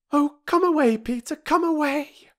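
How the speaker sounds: put-on high, frantic girls' cry; agonized plea. A narrator's reading. Sisters pleading with Peter to flee, high and frantic. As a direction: beg him to come away, panicked. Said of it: "in agonized unison".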